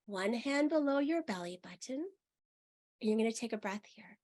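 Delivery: unhurried meditation guide; guided meditation. Acted. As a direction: lead them gently into the body.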